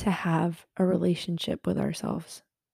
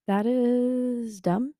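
usual speaking voice

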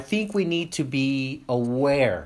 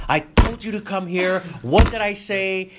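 louder, higher scolding; angry father; 6 semitones higher, 6 dB louder than usual. Shown as a demonstration. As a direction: snap at the kid to come here. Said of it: "when we are flustered, when we're upset".

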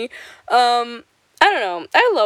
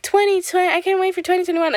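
mocking eager people; 10 semitones higher, faster than usual. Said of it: "a lot of people are like".

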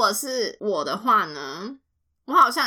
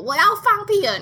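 loud, high proclamation to everyone; a mock public declaration; loud announcement; 11 semitones higher, 6 dB louder than usual. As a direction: announce it loudly to the room. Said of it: "你就会大声宣布说".

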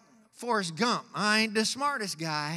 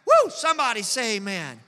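ordinary delivery

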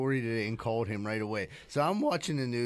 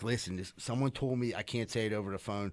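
hushed secretive; 5 semitones lower, 6 dB quieter than usual. Retold or imitated. Refusing to reveal source, prank.

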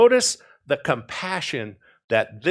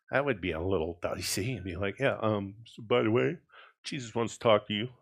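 lower, softer voice, as if slipping a message in; quiet aside; 6 semitones lower, 7 dB quieter, faster than usual. Acted. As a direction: drop it to a low, casual aside. Acted awkward aside to Mary.

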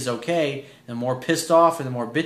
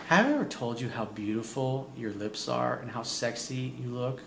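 sultry compliment; 9 dB quieter than usual. Shown as a demonstration. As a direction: murmur it close to her. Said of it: "you're gonna say".

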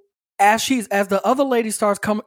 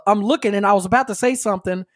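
ordinary talk, nothing marked

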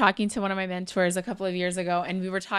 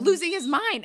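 animated emphasis; 7 semitones higher than usual. Retold or imitated. Describing customer's freakout.

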